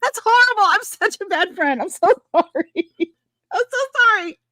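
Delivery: high, helpless laughing apology. Real, not acted. Bad friend confessing to Randy, apologizing through laughter.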